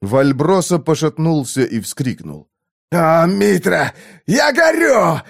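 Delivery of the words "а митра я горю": high, slow cry of burning pain; agonized cry. A narrator's reading. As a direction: cry out in agony. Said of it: "воскликнул он".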